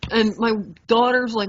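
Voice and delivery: normal talking voice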